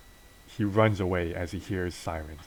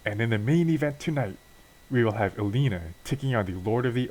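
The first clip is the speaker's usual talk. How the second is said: bright, raised ring-announcer read, punching the names; 3 semitones higher than usual. Acted. Wrestling show host hyping tonight's matches to viewers, announcer read. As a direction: hype up tonight's card like a TV host.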